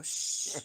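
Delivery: long, voiceless "shh"; drawn-out mock hushing; playful shush. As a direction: hush them in jest. Jokingly shushing after admitting rudeness.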